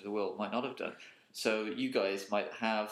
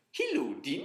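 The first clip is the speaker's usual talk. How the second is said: "Hello, Dean" with oddly misplaced pitch swoops; wrong inflections; 3 semitones higher, 3 dB louder than usual. Shown as a demonstration. Someone coming up to greet you, "Hello, Dean" in wrong inflections. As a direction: put strange high inflections on "Hello, Dean". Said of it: "they're putting all the inflections are wrong".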